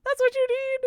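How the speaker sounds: high, loud nagging; exasperated insistence. Real, not acted. Telling Venom what they need to eat, exasperated insistence. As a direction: insist loudly, pitched right up. Scolding chatter over poor diet.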